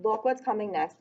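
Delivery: ordinary delivery, nothing marked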